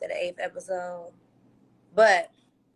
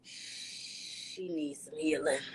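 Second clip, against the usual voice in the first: slow, softer, gently caring; softer, slow sympathy; 3 semitones lower, 9 dB quieter, much slower than usual. Retold or imitated. Imagined sympathetic viewer, slow soft caring tone. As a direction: say it like a kind fan.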